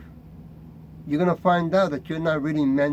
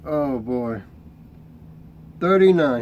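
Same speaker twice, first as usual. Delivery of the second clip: normal talking voice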